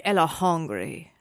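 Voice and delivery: slow, drawn-out mock French accent; toddler's French accent. Mother quotes her hungry daughter, in a slow French accent. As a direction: imitate a little one with a French lilt. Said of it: "she sounds French".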